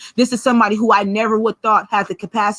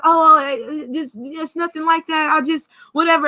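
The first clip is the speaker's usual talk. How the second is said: higher, halting mock stammer; flustered excuse; 5 semitones higher than usual. Partner caught bullying the child, mock flustered denial. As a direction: imitate someone caught out, fumbling upward.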